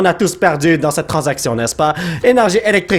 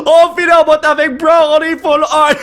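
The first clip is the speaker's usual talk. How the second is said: high and bright with laughter; bragging in delight; a touch of laughing triumph; excited; 8 semitones higher, 3 dB louder than usual. Real, not acted. Celebrating final pack hit.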